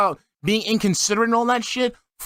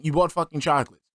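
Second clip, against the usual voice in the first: normal talking voice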